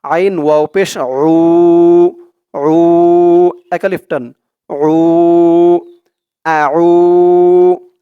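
উ held long and loud; stretched vowels. Shown as a demonstration. Teacher shows students the long vowel, stretched. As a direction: draw out উ and আউ. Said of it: "আমি যেখানে যেমন টান দিব".

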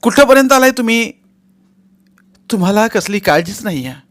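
loud, high scolding; angry. Acted. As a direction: scold him for not caring. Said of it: "ती चिडून म्हणाली".

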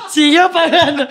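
high laughing delight. Real, not acted. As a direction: giggle through the story. Laughing while telling mom story.

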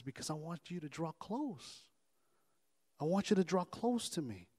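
soft, intimate answer; hushed, unhurried invitation; God speaking softly. Acted. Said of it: "why are you whispering to me".

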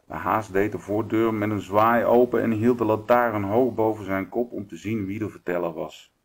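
ordinary delivery

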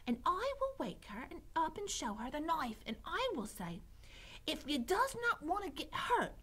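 put-on high, coarse-spoken serving-girl voice; eager serving girl Mig. A narrator's reading. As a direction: recite the plan in a high, simple-minded voice. Mig recites the plan.

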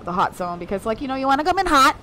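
ordinary talk

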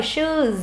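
"Shoes" with a long drawn-out U. Shown as a demonstration. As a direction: hold the vowel in "Shoes". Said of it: "U dài này".

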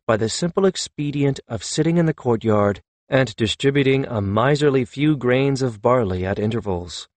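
plain reading voice

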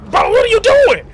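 high indignant shout; shouted protest at teammate; exasperated yelling. Real, not acted.

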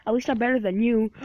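ordinary talk, nothing marked

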